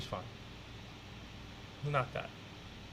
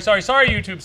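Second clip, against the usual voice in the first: normal talking voice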